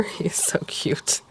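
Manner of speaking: breathy whisper, slow and fond; whispered coo. Real, not acted. Whispering to herself over a cute paper watermelon.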